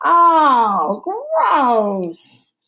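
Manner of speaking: loud disgusted cry. Acted. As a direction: recoil from the stink out loud. Smelling a fart in the woods.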